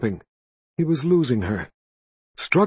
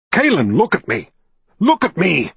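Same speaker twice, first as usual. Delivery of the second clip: louder, calling her name hard; urgent shout; 5 semitones higher, 5 dB louder than usual. A narrator's reading. Richard shouting at frantic Kaelin, urgent shout.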